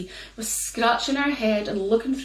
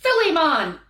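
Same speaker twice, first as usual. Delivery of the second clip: much higher, louder, half-laughing scold; mock fury at a foolish husband; wife scolding Sandy over the wasted wish; 12 semitones higher, 4 dB louder than usual. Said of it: "said his wife".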